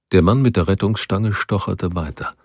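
ordinary delivery, nothing marked

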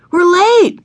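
put-on a little girl crying out she's late; excited cry. A narrator's reading. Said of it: "cried Ramona".

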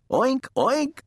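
high, perky pig oinks; oinking pig. A narrator's reading. Mercy the pig teases her chaser, high oinking. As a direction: oink twice, pitched high.

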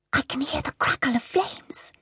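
high breathless whisper of a medium in trance; frightened whisper. Acted. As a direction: whisper as if hearing the fire close by. Medium in trance senses fire.

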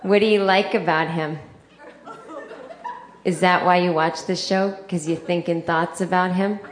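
put-on prying Boston accent mom; mother's Boston accent. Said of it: "she has a Boston accent".

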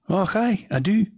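high, cheery Scottish och aye; Scottish accent. Acted. The Scot confirms to the other voice, broad Scottish. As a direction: answer proudly in broad Scots.

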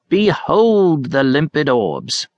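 put-on slow, high theatrical old man; hammy Shakespearean actor. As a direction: declaim it like a pompous stage actor. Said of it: "atrocious efforts to extemporize Shakespeare".

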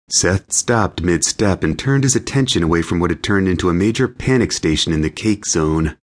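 ordinary delivery, nothing marked

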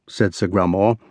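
ordinary delivery

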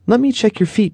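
lifted, caring mother's tone; mother's gentle voice. A narrator's reading. Mother checking her limping son's feet, lightly voiced caring. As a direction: lean in like a concerned mom.

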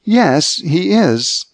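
a worried mother's pain, lifted in pitch. A narrator's reading. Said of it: "There was pain in her voice".

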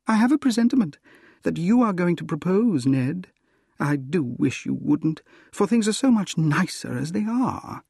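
high-pitched woman's voice, mildly chiding; acted smiling reproof; Gladys, gently reproving. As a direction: raise the pitch for a lightly scolding heroine.

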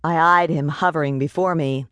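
plain reading voice

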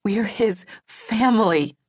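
"family" drawn out with weight; stress on family. A narrator's reading. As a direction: lean slowly on the word "family". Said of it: "I caught the emphasis on the word family".

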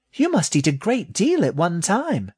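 very high little rat voice; high-pitched young creature in wonder; astonished baby rat. A narrator's reading. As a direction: marvel as a tiny, astonished rat. Astonished baby rat questions snake.

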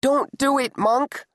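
put-on high girl's voice, pleading; girl crying out. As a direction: cry out to stop him. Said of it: "a girl's voice cried".

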